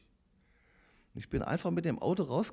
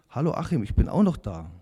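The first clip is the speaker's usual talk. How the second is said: louder calling voice of God; God's voice, louder; 9 dB louder than usual. Retold or imitated. Inner voice of God calling Achim, louder. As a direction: call his name out, insistent. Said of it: "diese Stimme, die zu mir gesagt hat".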